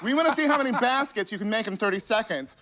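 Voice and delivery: high, bright host read; game show host. Acted. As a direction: announce the bonus game like a TV host. Game show host reading the bonus game.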